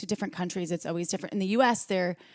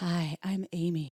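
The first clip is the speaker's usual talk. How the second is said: soft, low sultry greeting; sexy voice; 2 semitones lower, 5 dB quieter than usual. Retold or imitated. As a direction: purr it out seductively. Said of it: "Amy's sexy voice".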